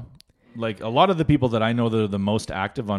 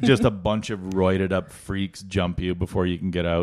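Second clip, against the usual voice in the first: ordinary delivery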